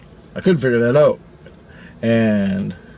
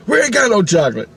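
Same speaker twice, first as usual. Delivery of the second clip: high, testy mock refusal; acted-out annoyed ice cream man; irritated vendor; 9 semitones higher, 3 dB louder than usual. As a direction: turn the customer down in a raised pitch. Vendor snaps back at order.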